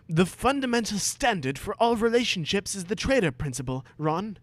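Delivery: put-on lofty preachy boy. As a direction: lecture like a smug know-all.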